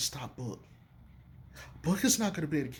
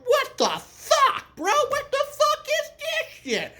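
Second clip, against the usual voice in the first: high, loud, slow mock outrage with a laugh; imitating Tristan; 7 dB louder, slower than usual. Retelling how Tristan reacted to Zion, hammed-up shocked squeal. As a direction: play the stunned player, squealing in disbelief. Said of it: "Tristan was like".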